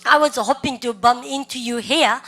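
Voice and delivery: very slow, each word spelled out for students; teacher's slow drill read; slow repeat-after-me. Shown as a demonstration. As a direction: model it slowly for the class to copy. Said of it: "시작".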